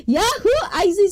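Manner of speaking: high, loud cheery yahoo; excited whoop. Real, not acted. Livestream host cheering at a chatter, excited whoop. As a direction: let out a high-pitched whoop.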